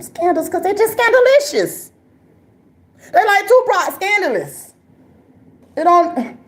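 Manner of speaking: high, sing-song scandalicious; high mock delivery. Comic mocking the scandal-prone crowd, high and sing-song. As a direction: toss it up high like a playful put-down.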